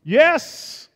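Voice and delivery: high, loud burst of joy; triumphant shout. Real, not acted. Revealing the photo of the peach harvest, triumphant. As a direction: yell it like you just won.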